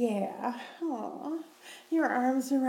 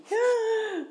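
high giggle; 13 semitones higher than usual. Acted. Reminiscing about party days.

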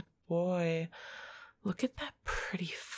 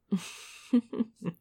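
teasing laugh; 5 dB louder than usual. Acted. Teasing during intimate roleplay.

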